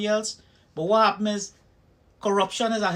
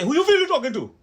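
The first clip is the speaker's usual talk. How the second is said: loud, high, furious retort; officer bristling at being questioned, angry shout; 10 semitones higher, 6 dB louder, faster than usual. Retold or imitated. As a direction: throw it back like a cop losing his temper. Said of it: "he get real intense and mad".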